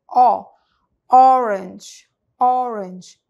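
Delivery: emphatic round O; round O, loud. Shown as a demonstration. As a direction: round the mouth and hit "O,". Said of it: "olha o formato da boca".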